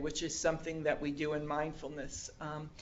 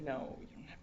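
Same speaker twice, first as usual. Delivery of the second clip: hushed refusal; 3 semitones lower, 10 dB quieter than usual. Retold or imitated. Refusing the gift.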